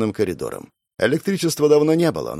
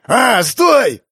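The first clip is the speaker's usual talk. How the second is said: high, loud cry to stop; desperate yell; 11 semitones higher, 6 dB louder than usual. A narrator's reading. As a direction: yell in sudden terror. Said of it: "Не успевает мужик доорать". (отчаянный крик)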